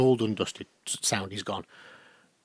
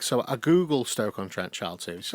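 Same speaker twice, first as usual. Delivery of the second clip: ordinary talk, nothing marked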